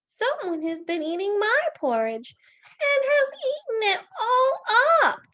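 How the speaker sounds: very high, loud little bear; high-pitched baby bear wail; Baby Bear's wee voice. Acted. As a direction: squeal it like a tiny upset bear cub. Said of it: "he said in his wee little voice".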